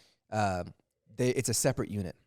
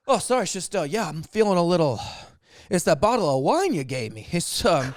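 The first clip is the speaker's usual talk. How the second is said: higher, louder tipsy guest voice; imitated drunk; 5 semitones higher, 5 dB louder than usual. Drunk guest blaming the host's wine, imitated drunk. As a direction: fumble through it as a mock drunk. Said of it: "like, drunk, like, very drunk".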